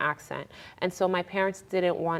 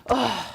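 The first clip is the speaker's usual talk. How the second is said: mock exasperated "ugh", pitched up; higher, frustrated sigh of a groan; 5 semitones higher than usual. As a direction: imitate mom's fed-up groan. Retold mother's exasperated groan.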